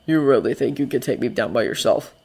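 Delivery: low, quiet incredulous challenge; low-voiced defiant disbelief; defensive, incredulous. A narrator's reading. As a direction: doubt him under your breath, guarded. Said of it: "defensive and incredulous all at once".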